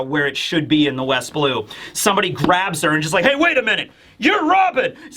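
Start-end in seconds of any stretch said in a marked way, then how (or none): 3.2-5.0 high, acted exclamation rising to a yell; startled recognition. Stranger grabbing the wanted girl, mock startled shout. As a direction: call out as if spotting a fugitive.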